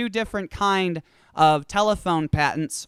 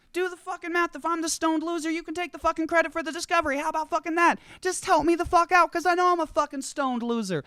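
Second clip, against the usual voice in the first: much higher, whiny pleading rant; pleading stoner Joe; 9 semitones higher than usual. Acted. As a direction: wheedle and beg for five minutes.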